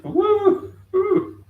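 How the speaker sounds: loud, high whooping cheer; a high, loud whoo twice over; excited whoop. Real, not acted. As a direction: let out a wild party whoop. Whooping about weekend women.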